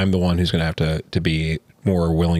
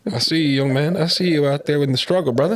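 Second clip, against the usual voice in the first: higher-pitched mock old-timer greeting; imitated older man; 8 semitones higher than usual. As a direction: hail a young brother like a knowing elder, jokingly.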